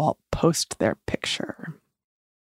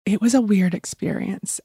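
ordinary talk

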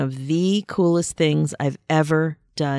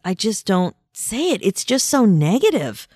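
ordinary delivery

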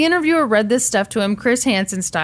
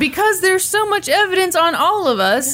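high, pleading mockery; mock him as helpless; mock whiny dad; 7 semitones higher, 3 dB louder than usual. Mocking Scott's excuse.

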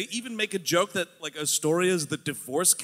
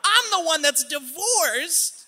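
catty mom voice; 13 semitones higher, 4 dB louder than usual. Acted. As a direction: snap at her like a jealous mom. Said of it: "they're, like, fighting".

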